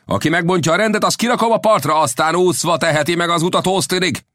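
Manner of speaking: high, forceful captain's warning; shouting ship's captain. A narrator's reading.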